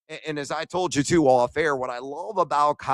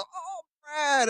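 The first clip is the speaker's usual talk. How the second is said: much higher, mock-delighted greeting; imitating Ian Eagle. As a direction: chirp his name up high like a happy broadcaster. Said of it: "He goes".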